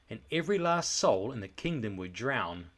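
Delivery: ordinary delivery, nothing marked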